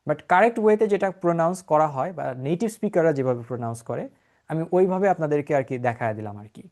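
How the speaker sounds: normal talking voice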